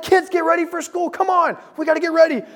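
put-on high-pitched frantic yell at the kids; angry yelling parent. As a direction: holler like a frustrated parent running late. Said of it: "you're angry and you're yelling at them".

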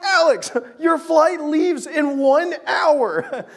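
high, loud, slowly spelled-out warning; acted urgent shout. Warning friend about to miss flight.

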